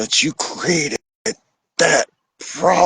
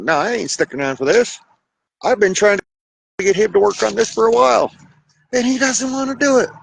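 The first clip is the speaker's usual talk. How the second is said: higher, faster, exasperated partner voice; fed-up partner; 10 semitones higher, faster than usual. Retold or imitated. Partner who is done with him, high exasperated complaint. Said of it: "intelligent person be like".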